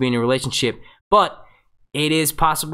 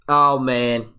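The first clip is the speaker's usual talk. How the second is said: ordinary delivery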